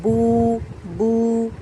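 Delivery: बू with a pure long ऊ vowel; बू drawn out as a pure oo, not o; long oo sound. Shown as a demonstration. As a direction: say बू with lips rounded, clean ऊ. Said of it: "ऊ sound निकालना है".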